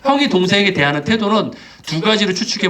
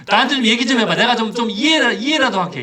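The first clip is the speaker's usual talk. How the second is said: mock interrogation, pitched high and pressing; demanding an explanation; 7 semitones higher than usual. Father questioning his returned son, pressing and high-pitched. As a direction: press for answers in a raised pitch. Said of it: "이런 따지지도 않았어요".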